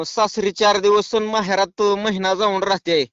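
ordinary delivery

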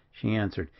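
ordinary delivery

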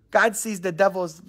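ordinary delivery

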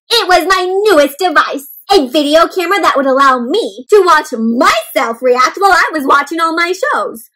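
lightly voiced eager couch potato; excited about the new gadget; a hint of a proud couch potato. Couch Potato's lines in book.

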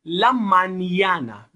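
"La mañana" drawn out slowly and loud, ñ said as "ny"; slow model pronunciation. Shown as a demonstration. Teacher models ñ for learners: slow, loud "La mañana".

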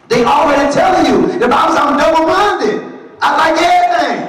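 high, loud preaching climb; full-force preaching. Acted. Preacher shouting at the congregation, full force.

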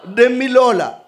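loud, fast, high preaching to the congregation; full-force preaching. Acted. Preacher addressing the brothers in Christ, loud preaching. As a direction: preach it out over the music.

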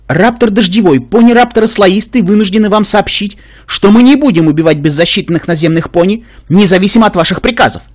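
high, loud, resolute officer; firm defiant announcement. Acted. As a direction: declare it with resolve. Мятежный экипаж объявляет отказ. (твёрдо и решительно)

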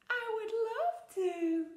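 very high, loud, slow eager duck; delighted duck voice. Acted. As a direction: gush the yes like a trusting duck.

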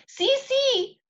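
put-on very high giggly toddler voice; very high, lightly laughing small boy. A narrator's reading. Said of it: "Fudge laughed".

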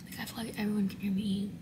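hushed whisper in a quiet hallway. Real, not acted. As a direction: keep it to a breathy whisper.

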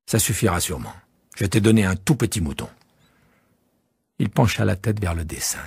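plain reading voice

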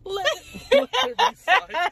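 high, laughing through the words. Real, not acted. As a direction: giggle it out while quoting. Echoes joke laughing.

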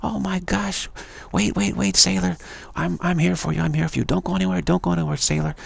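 high, put-on seductress calling out; beckoning goddess. Beautiful goddess luring a sailor to stay, beckoning. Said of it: "along comes this beautiful goddesses, and they say".

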